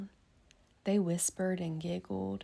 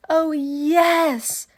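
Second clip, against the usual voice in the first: loud, much higher delighted cry; kids cry out; 9 semitones higher, 13 dB louder than usual. Acted. Kids thrilled about story hour, loud high cheer. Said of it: "cried the kids".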